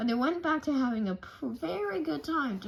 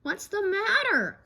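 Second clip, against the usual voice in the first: higher worried cub voice; worried child voice; 9 semitones higher, 5 dB louder than usual. Acted. Brother asking his crying mother, high worried child voice. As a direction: ask it like a scared little boy. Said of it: "asked Brother".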